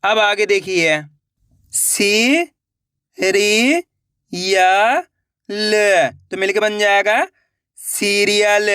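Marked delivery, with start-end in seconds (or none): 1.7-6.1 सी, री, या, ल each said on its own with pauses; letter by letter. Shown as a demonstration. Teacher sounding out serial for pupils, syllable by syllable. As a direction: call out each syllable separately. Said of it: "एक एक अक्षर का उच्चारण करके".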